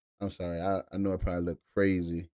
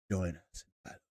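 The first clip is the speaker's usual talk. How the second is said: low, soft, ominous call to battle; a hint of a dark game villain; lightly voiced sinister herald; dramatic invitation; 4 semitones lower, 7 dB quieter than usual. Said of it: "Why say it like that?".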